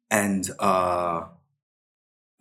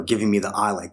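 usual speaking voice

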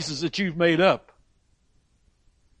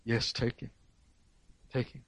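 much softer, lower giving way; quiet yielding; 4 semitones lower, 12 dB quieter than usual. Acted. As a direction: let him go, barely above a murmur.

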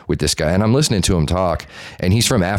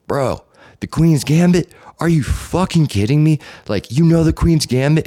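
incredulous; 10 semitones higher than usual. Retold or imitated. Telling friend how he challenged the chess player, incredulous. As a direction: act out astonished recognition.

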